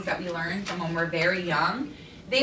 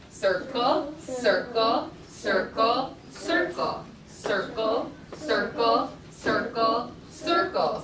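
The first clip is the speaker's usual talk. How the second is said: "circle" chanted steadily, one per beat; much slower than usual. Shown as a demonstration. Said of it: "say circle very fluently, quickly".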